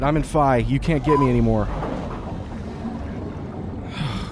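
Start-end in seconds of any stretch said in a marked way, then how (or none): 1.7-3.9 long relieved sigh. Real, not acted. After a stressful escape.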